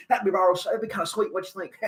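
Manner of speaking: put-on folksy Texan president talking to the kids; George W. Bush impression. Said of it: "impersonation of George W. Bush".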